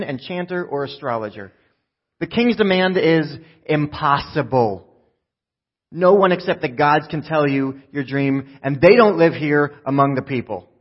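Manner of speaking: loud, emphatic fed-up protest; pretense gone. Acted. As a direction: drop the politeness and push every word out. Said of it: "gone is the pretense".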